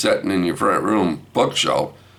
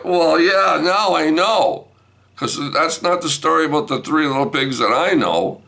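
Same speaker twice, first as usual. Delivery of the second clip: high, raised-voice comeback; exasperated retort; 10 semitones higher than usual. Retold or imitated. Grandpa retorting to wife, high exasperated voice. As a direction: fire back, pitch up throughout.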